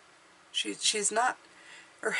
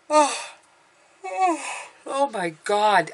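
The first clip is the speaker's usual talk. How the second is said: talking through a yawn; words stretched by a big yawn; 5 semitones higher, 4 dB louder, slower than usual. Real, not acted. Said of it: "I just yawned".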